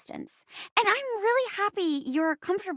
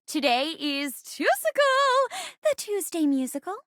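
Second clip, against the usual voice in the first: musical show announcer; 5 semitones higher than usual. Acted. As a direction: stretch the last word high like a musical number. Host announces the musical day.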